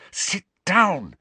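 high, strained, over-articulated order; frantic, stretched-out words; exaggerated mouthing. A narrator's reading. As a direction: mouth it urgently across a room. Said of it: "she mouthed to Ernie and Hannah".

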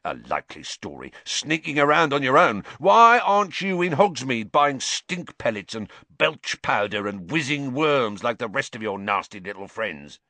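accusing, bad-tempered caretaker's rant; spitting scorn. A narrator's reading. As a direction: build from disbelief into a nasty tirade. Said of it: "spat Filch, his jowls quivering unpleasantly".

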